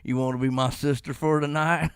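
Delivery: low, soft mock come-on; Alabama creep. Wrestler propositioning the coworker, low mock Alabama creep. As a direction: impersonate a sleazy Alabama suitor, low and quiet. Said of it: "He looks like he's from Alabama".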